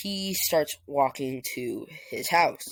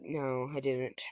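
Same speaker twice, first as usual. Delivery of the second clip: soft, low embarrassed reply; sheepish mumble; 3 semitones lower, 7 dB quieter than usual. Retold or imitated. Son Deku admits losing fight, soft sheepish. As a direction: admit it meekly. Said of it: "Deku's just like".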